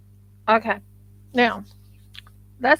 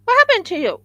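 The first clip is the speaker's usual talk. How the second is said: surprised; 10 semitones higher, 8 dB louder, much faster than usual. Real, not acted. Startled at the figure.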